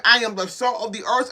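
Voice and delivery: normal talking voice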